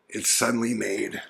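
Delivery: usual speaking voice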